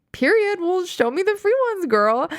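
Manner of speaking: high, bright burst of glee at free frames; excited. Retold or imitated. Telling the optician to show her the free frames, thrilled and squealing high. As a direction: squeal it like you just won something.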